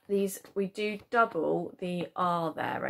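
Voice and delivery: usual speaking voice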